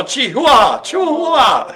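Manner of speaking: high, loud hammed-up cry; over-the-top high-pitched announcement; mock shouting. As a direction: belt out the breed name in a silly high shout. Hammed-up shouting of breed name.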